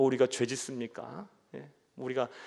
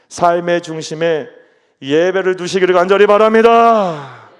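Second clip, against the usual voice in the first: rising, loud fervent appeal; preaching at full force; 4 semitones higher, 13 dB louder than usual. Real, not acted. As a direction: lift it into a loud plea. Preacher climaxes exhortation. (힘차게 외치는 설교)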